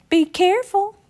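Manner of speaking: a worried mother calling after them; mother's warning. A narrator's reading. Mama calling after the boys, high and anxious.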